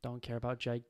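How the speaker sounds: normal talking voice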